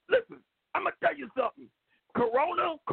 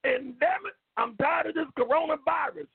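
ordinary talk, nothing marked

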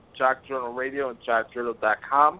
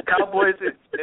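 laughing; slower than usual. Real, not acted. Laughing mockery of Cowboys.

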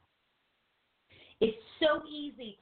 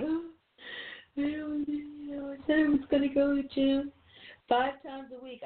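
high, droning whine of letdown; put-on sheepish moan, higher than usual; mock glum excuse; 5 semitones higher than usual. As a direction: whine it like a defeated quitter. Voicing depressed people who missed their goals.

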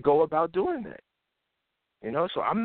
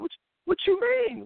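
incredulous. Real, not acted. Incredulous at fearful people.

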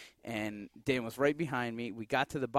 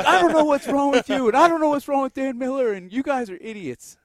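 much higher, louder exasperated yelling; angry dad; 13 semitones higher, 12 dB louder than usual. Retold or imitated. Dad ranting at his son and Dan Miller, loud and exasperated. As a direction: rant at the son at full volume.

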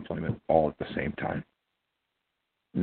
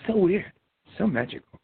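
mock amazement; 12 semitones higher than usual. Mock wonder at strange noises.

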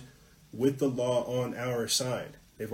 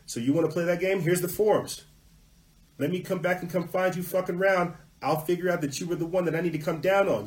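raised-pitch, combative challenge; worked-up warning, pitched higher; acted threat; 5 semitones higher than usual. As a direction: confront the official head-on. Acted threat to confront officials.

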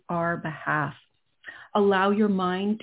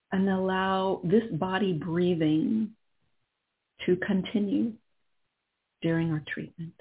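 calm and unhurried, guiding inward; right in your ear. Acted. Leads a guided meditation.

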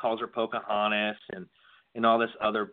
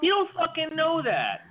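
much higher, indignant pushback; heated protest. Real, not acted. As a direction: shoot back high and indignant. Heated argument over Warren.

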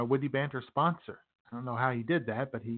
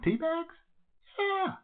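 high squeal; slower than usual. Acted. Excited jump up high.

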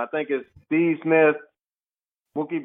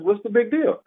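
higher, animated self-encouragement; retold pep talk; 8 semitones higher than usual. Player psyching himself up before the game, pep talk. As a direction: talk yourself up, pitch lifted.

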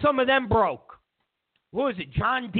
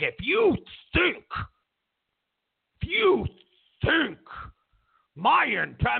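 mock indignant Comey; 6 semitones higher than usual. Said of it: "Comey looked at him and he was like".

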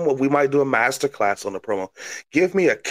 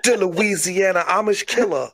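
higher-pitched mock Cajun announcement; bill yourself in a hammed-up Cajun accent; playful Cajun promo voice; crazy Cajun; 6 semitones higher than usual. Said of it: "You're a crazy Cajun".

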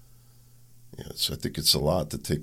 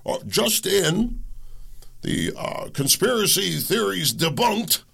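mock news anchor; 14 semitones higher than usual. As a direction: ham up a breathless newsreader. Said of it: "none of this media".

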